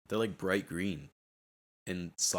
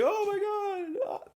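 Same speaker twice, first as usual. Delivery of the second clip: high, slow, hammed-up yell; a dramatic fake scream of fright; mock shout; slower than usual. Kid playing up fear of the TV beans. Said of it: "I'm not gonna yell, like".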